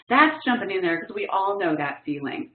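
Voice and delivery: ordinary talk, nothing marked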